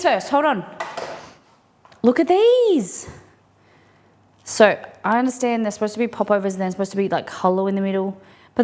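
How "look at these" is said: high, slow, squeaky delight. Real, not acted. Showing off fresh popovers.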